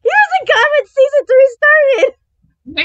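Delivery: high-pitched, drawn-out insistence; insistent objection. Real, not acted. Arguing with a friend over when Bastion left, insistent. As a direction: argue it back, pitched well up.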